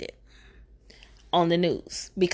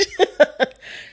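real laugh; 7 semitones higher, 4 dB louder than usual. Real, not acted. Laughing at own joke.

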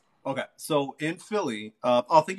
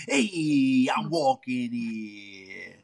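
lightly voiced New York accent, very slow; New York impression; much slower than usual. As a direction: stretch "walking" in a mild New York accent. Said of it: "that's my New York impression".

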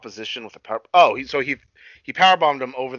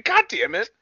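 higher, hammed-up frustrated curse; mock exasperation. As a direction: play it like a fed-up wrestler, pitched high. Retelling a wrestler's frustration.

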